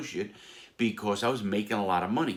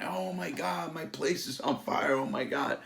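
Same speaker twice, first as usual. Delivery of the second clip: high, hammed-up panic; mock alarm; 8 semitones higher than usual. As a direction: play the innocent, shocked owner. Faked shock at his fire.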